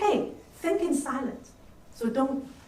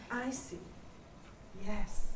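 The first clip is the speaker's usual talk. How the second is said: a soft "I see" with a pause, then a gentle "Yes"; calm, well-timed acknowledgement; 5 semitones lower, 12 dB quieter, slower than usual. Shown as a demonstration. Teacher showing the right way to listen, softly acknowledging. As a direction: acknowledge quietly at the right moment. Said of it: "So at the right time".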